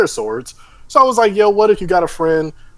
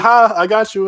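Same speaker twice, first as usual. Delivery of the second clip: mock prankster; 8 semitones higher, 5 dB louder than usual. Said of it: "I just be like".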